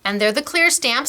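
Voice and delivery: ordinary talk, nothing marked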